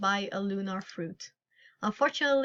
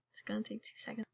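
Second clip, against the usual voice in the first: quick hushed whisper to the viewers; whispered aside; 14 dB quieter, faster than usual. Real, not acted. Streamer whispering an aside to viewers while something dropped. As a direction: whisper it, as if not to disturb anyone.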